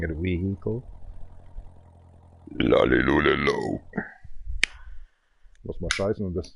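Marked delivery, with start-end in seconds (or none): 2.6-3.8 deep silly voice. Acted. Nonsense word in odd voice.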